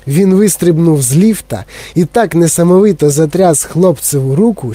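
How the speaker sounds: ordinary delivery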